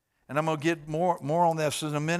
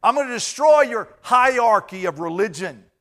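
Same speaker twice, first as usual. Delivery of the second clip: louder, higher preached declaration; preaching at full force; 4 semitones higher, 8 dB louder than usual. Acted. Preacher proclaiming Jesus's words to the congregation, booming. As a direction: thunder it from the pulpit.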